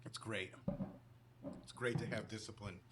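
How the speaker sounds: dry, quiet deadpan. Real, not acted. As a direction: say it dryly and flat. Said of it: "What's it like to have restraint and discipline".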